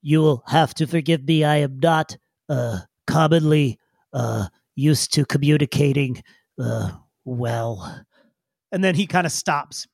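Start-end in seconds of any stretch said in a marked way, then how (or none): 0.0-8.0 halting, lower-pitched formal creature; awkward digger. Acted. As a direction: stop and start, searching for words. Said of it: "for a moment he says".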